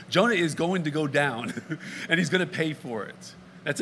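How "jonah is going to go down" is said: normal talking voice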